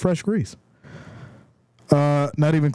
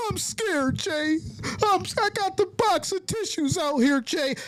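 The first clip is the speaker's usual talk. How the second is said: high, whiny imitation of scared fans; teasing fake-sobbing falsetto; mock panicked crying; 11 semitones higher than usual. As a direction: mimic weepy fans freaking out, pitched way up. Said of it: "All them people crying and freaking out".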